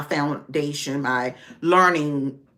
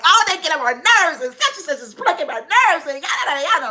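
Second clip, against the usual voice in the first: mock venting rant; 10 semitones higher, faster than usual. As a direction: vent like a fed-up boss at home. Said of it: "I'm yelling and screaming".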